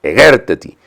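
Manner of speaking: Egerteti with the second E stressed; Egerteti, accent clearly on the second syllable. Shown as a demonstration. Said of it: "el acento está en la segunda E".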